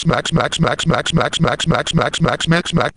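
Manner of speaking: mouthed smacking noise. Acted. Imitated slapping noise.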